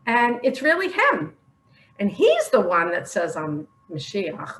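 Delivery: ordinary talk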